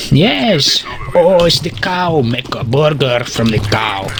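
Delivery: usual speaking voice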